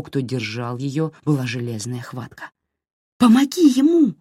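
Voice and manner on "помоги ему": high urgent plea; desperate cry. A narrator's reading. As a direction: cry out for help. Red cries out desperately.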